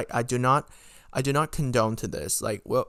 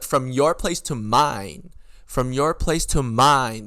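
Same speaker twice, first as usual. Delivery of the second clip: slow, louder, every word spelled out; exasperated emphasis; 6 dB louder, slower than usual. Retold or imitated. Retelling her texted clarification to the friend, exasperated and emphatic. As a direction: stress YOUR and MINE, drawn out slowly. Exasperated repeat of own reply.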